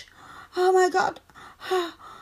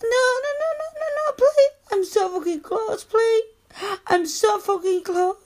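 high, urgent protest; frantic pleading; 4 semitones higher than usual. Acted. Woman begging partner not to stop, frantic. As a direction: plead in a rising panic.

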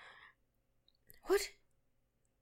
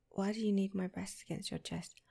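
ordinary talk

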